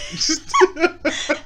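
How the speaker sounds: high, squealing giggle; shrieking fit of giggles; loud giggle. Real, not acted. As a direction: burst into high laughter. Giggling at awkward topic.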